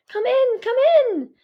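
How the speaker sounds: high, loud eager call; shouted, high "come in, come in"; yelling. A narrator's reading. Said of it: "I yell from the bedroom".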